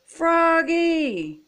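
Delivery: high drawn-out call, dropping at the end; storybook yell. A narrator's reading. Frogalina calling after Froggy as he runs off, yelling. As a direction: call out the name as if across a field. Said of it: "yelled Frogalina".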